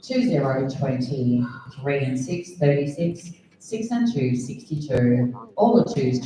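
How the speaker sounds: sing-song number calling; bingo caller. Acted. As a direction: call each number like a bingo host.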